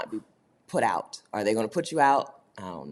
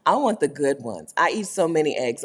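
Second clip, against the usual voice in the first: ordinary talk, nothing marked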